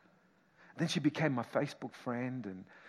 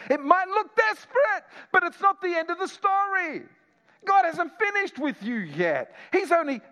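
high and loud, full force; rousing preaching; 13 semitones higher, 9 dB louder than usual. Acted. Pastor to his congregation, rousing high and loud. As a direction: lift the church with it.

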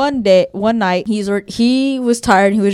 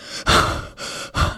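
voiceless sharp intake of breath; imitated breathless gasp. Something behind the father gasps, a voiceless gasp. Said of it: "he said he heard".

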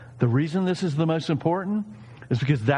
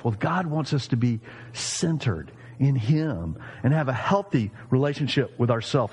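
ordinary delivery

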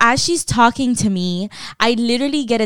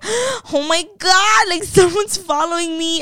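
high-pitched wail of mock panic; hammed-up frightened whine, much higher; freaking out, near tears; 9 semitones higher than usual. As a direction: re-enact a tearful panic, stretching the first words. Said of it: "crying 'cause I'm freaking out".

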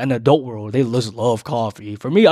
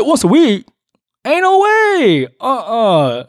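high, loud disbelieving squeal; can't believe what she just said; incredulous; 11 semitones higher, 6 dB louder, slower than usual. Retold or imitated. To his date, a high loud incredulous squeal.

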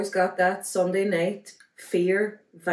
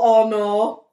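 retold dismay; 10 dB louder than usual. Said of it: "and I was all".

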